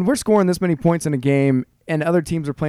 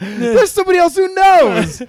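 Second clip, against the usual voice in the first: high, slow delighted shout; overjoyed someone else knows; excited; 5 dB louder, slower than usual. Real, not acted.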